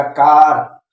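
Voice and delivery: "कार" said with a long aa, like car; read as car. Shown as a demonstration. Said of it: "आ पढ़ा जाएगा".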